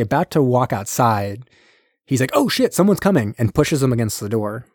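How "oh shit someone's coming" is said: high-pitched, hammed-up warning; mock alarm. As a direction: jump up high as if nearly caught. Acted alarm at being nearly caught.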